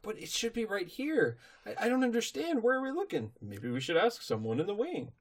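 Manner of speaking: high-pitched, baffled searching; acted bewilderment. Recapper plays a lost pony hunting the castle, bewildered. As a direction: fret upward as if lost.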